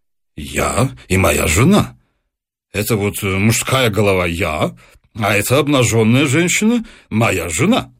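a painter explaining his canvas in a deep voice; artist's low voice. A narrator's reading. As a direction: speak low and plainly proud of the portrait.

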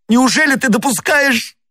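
very high, distraught cry; anguished near sob. A narrator's reading. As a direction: cry it out on the edge of sobbing. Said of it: "Голос его перешёл в рыдание". (отчаянный вскрик, переходящий в рыдание)

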